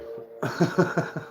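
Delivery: high chuckling laugh; real laugh. Real, not acted. Streamer amused by the live chat, laughing. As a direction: chuckle in a quick run of pulses.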